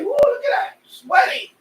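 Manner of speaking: high, loud woo at the sweat; real excited yelp. Real, not acted. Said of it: "I got sweat today".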